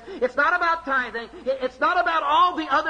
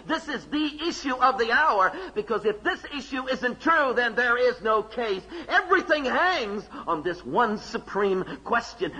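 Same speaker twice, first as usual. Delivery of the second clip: ordinary talk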